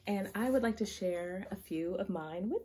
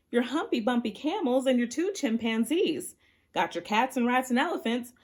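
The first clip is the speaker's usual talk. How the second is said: higher, louder call across the rain; 7 semitones higher, 7 dB louder than usual. Acted. Noah calling up to the Lord from the ark, higher and louder. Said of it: "he called out as they went through".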